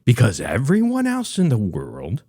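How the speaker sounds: ordinary delivery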